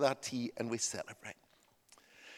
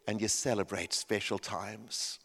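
slow, weighty, lowered pitch; solemn sermon point; 6 semitones lower, 5 dB quieter, slower than usual. Real, not acted. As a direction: let each phrase land gravely. Preacher closes the solemn point.

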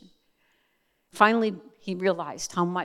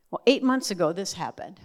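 ordinary talk

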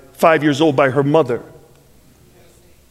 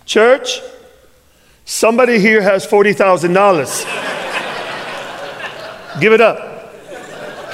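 pulpit appeal; 5 semitones higher, slower than usual. Retold or imitated. Pastor calling out to his church.

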